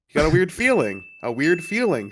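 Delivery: mocking repetition. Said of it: "said feeling three times in a row".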